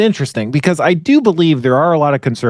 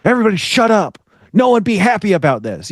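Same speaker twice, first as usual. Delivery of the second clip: high-pitched mock outburst; mock scolding; 9 semitones higher than usual. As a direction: play an exasperated killjoy hushing everyone. Acted scolding of people celebrating.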